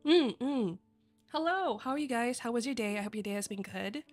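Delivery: usual speaking voice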